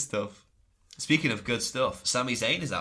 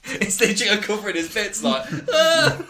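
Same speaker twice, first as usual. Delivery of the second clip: words breaking into laughter, higher and louder; laughing while telling; 11 semitones higher, 10 dB louder than usual. Real, not acted. Host retelling Bo's naked cover-up, laughing hard. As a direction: laugh through it.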